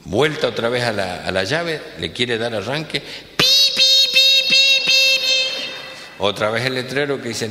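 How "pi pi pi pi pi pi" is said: very high, loud electronic beeps; car beeping. Acted. Car alarm beeping at the boss, very high. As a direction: chirp like a car warning alarm. Imitating the car's warning beep.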